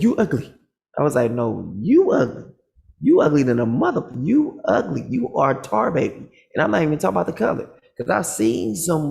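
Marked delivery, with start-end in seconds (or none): 0.0-0.5 mock insult from bald man. 1.3-2.4 slow comeback. Retold or imitated. 3.0-6.3 roasting the bald man back; heated taunting. Retold or imitated. Escalating insult high.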